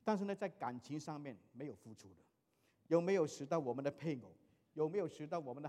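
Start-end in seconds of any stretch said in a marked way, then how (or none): none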